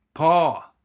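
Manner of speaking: high, loud shout calling out for Pa. A narrator's reading. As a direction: yell Pa across the camp to get his attention. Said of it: "Lanny shouted".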